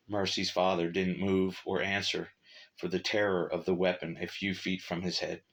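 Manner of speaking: plain reading voice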